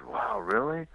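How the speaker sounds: a mocked-up teen's wow, pitched high; imitating a teenager's amazed wow; impressed surprise. Teen at the pizza parlor, amazed by the ride, high surprised wow.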